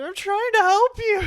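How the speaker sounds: high frantic shout; mock panicked yell. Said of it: "I think he's screaming at one point too".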